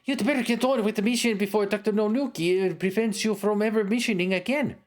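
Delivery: high, sharp woman's voice; woman cleric voice. Acted. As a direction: voice the cleric scolding him. The cleric goes on.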